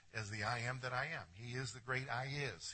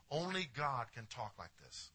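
usual speaking voice

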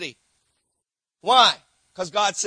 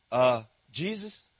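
sheepish sinner; 8 semitones lower, slower than usual. Acted. Sinner returns again.

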